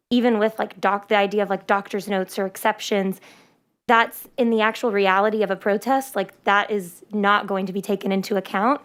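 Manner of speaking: usual speaking voice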